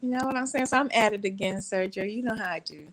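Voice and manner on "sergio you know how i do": normal talking voice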